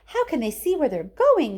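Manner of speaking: high, loud frightened cry; shriek. A narrator's reading. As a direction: squeal it into the dark. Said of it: "shrieked Violet Beauregarde".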